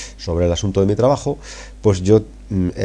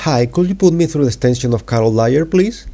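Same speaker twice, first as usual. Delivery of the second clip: lightly voiced caller, a little higher on please; caller reading dialogue; 7 semitones higher, 4 dB louder than usual. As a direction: read the caller's polite request. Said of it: "os la voy a leer". (el amigo pide la extensión por teléfono, un poco más agudo)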